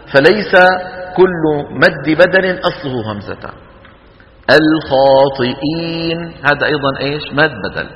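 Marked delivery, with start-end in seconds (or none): 4.6-6.3 slow الخاطئين with long held vowels; measured elongation example. Shown as a demonstration. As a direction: recite الخاطئين drawing out the final "iin". Recitation example of lengthening. (الخاطئين بترتيل ومد)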